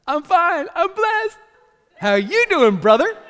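high, very loud over-the-top cheer; hammed-up "everything's great" shout; fake cheerful churchgoer. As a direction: belt out a mock-upbeat church greeting. Faking being fine at church.

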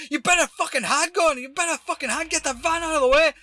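high, loud irate yelling; imitated angry threat. Stranger demanding the van be moved, imitated angry yell. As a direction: yell a warning as the mad stranger. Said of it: "Like that. And then he goes".

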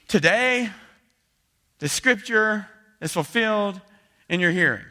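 booming Messiah declaration; grand proclamation. Retold or imitated. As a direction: proclaim it loud and high, slowly. Repeats the proclamation, more grandly.

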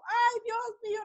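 high-pitched cry of dismay; retold alarm. Mother retelling her fright at the base's dangers, alarmed. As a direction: exclaim it high, like a worried mother.

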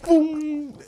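high punchy boom; high sound-effect boom. Retold or imitated. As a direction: mimic the dog's pounce. Dog pouncing on camera.

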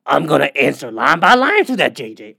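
lightly voiced pundit, brushing off the point; a hint of a TV pundit promising a rebuttal; mock Stephen A dismissal; Stephen A impression. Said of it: "and then he just goes".

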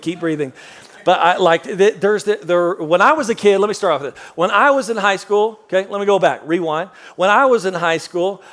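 usual speaking voice

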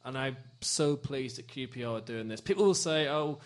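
a sincere club statement, soft and proud; heartfelt formal speech. Acted. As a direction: speak it like a proud tribute, slowing on key lines. Heartfelt speech about Grenfell support.

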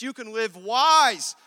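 loud and slow, stretched out; booming, drawn-out promise; preaching build. Acted. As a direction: lift it to a big preached climax. Preaching voice of Jesus.

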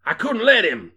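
acted shout of protest; bellowing. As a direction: bellow back at the crowd. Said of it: "bellowed Hardman".

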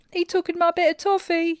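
high-pitched howling bear cub with a human voice; yelling small bear. A narrator's reading. Small bear tells on the other bear, high yelling. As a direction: pipe up in high, indignant complaint. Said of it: "yelled the bear in a very human voice".

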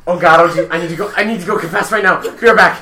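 loud, high, flustered outburst; mock panic. Telling co-hosts he must go confess, mock-panicked. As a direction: blurt it out as if scandalised. Feigns horror at the swearing.

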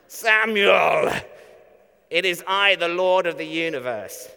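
mock booming voice of God; big booming holy voice. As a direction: boom it out like a grand divine broadcast. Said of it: "in a big, booming, Brian, blessed voice".

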